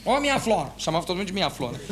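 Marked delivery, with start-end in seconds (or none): 0.0-0.7 hearty telephone greeting, higher-pitched; raised, drawn-out impersonated hello; Doutor Paulo. Retold or imitated. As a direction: imitate an old boss answering the phone. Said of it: "tinha uma voz mais ou menos assim".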